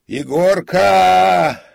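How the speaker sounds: very loud, high, long-held call; drawn-out shout. A narrator's reading. Comic calls again for the servant, long echoing shout. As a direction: holler the name, stretching the end. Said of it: "крикнул он".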